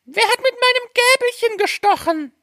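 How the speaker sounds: extremely high, squeaky dwarf voice; fifth dwarf. A narrator's reading. Fifth dwarf asks about his fork, tiny squeaky voice. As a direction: squeak it right at the top of the range. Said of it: "Der fünfte".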